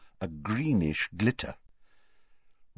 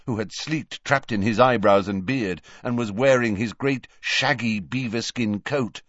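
plain reading voice

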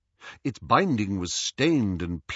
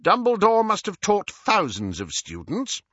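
higher-pitched, insistent official; Scrimgeour persevering; 11 semitones higher, 3 dB louder than usual. Acted. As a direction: press the point like an interrogator. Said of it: "Scrimgeour persevered".

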